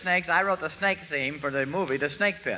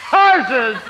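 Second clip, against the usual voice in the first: excited shout; 7 semitones higher, 10 dB louder than usual. Acted. Excited outburst.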